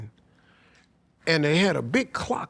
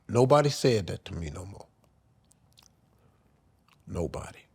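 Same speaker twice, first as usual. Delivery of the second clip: grieving, trailing off; 7 semitones lower than usual. Real, not acted. Son remembering nobody said they were proud, grieving. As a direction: let the last word die away in grief. Grief over dead father.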